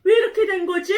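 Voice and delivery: high, loud bewildered outcry; acted storybook astonishment; shocked surprise. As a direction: exclaim in dismay at a sudden loss. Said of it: "이렇게 유나가 깜짝 놀랐어요".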